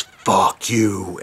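a hint of a deep demonic man's voice; lightly put-on possessed voice, not her own; retelling the old woman's curse in a mildly altered voice. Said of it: "not like a deep woman's voice, a man's voice".

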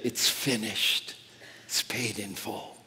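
quiet awe; solemn hush. Acted. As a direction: lay it down gently, fully settled. Solemn climax of the cross.

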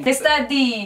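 "Che sta di" cut short in Roman dialect; Burino Roman accent. Shown as a demonstration. Teaching a friend Roman speech, Burino accent. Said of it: "an accent that's called Burino".

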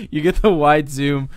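usual speaking voice

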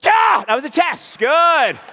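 high loud victory yell; excited shout after the catch; triumphant shout. Real, not acted. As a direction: burst out cheering. Surprise throw caught.

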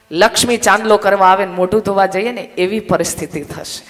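ordinary talk, nothing marked

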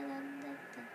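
normal talking voice

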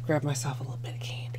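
hushed whispered confession; whispering. Real, not acted. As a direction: whisper it like a secret. Confessing the candy grab.

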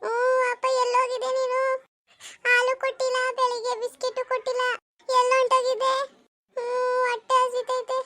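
put-on slow, drawn-out child's whine; tearful whining. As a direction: whimper at your father with stretched endings. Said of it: "ಇದ್ಯಾಕ್ ಅಳ್ತಾ ಇದ್ದೀರಿ". (ಅಳುತ್ತಾ ರಾಗ ಎಳೆಯುವುದು)

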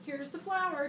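loud, proud call; shouted. A narrator's reading. Jack calls to his mother with the flour, loud. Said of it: "shouted Jack".

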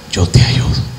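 breath-soft promise of help; almost whisper it as a comfort; whispered reassurance. Acted. God takes you by the hand, whispering reassurance.

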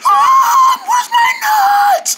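very high, drawn-out squirrel squeal; mock her as a shrieking squirrel; empty squirrel impression. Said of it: "She sounds like an empty squirrel".

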